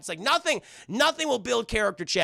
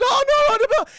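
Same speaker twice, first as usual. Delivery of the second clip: very high, loud mock panic; hammed-up frantic squeal; panicked dismay; 10 dB louder than usual. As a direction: imitate a cartoon dog wailing. Dog panics at monster.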